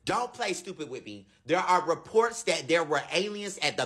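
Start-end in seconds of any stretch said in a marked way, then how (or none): none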